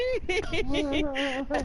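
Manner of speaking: high-pitched giggle; embarrassed high laugh. Real, not acted. Laughing after an awkward admission.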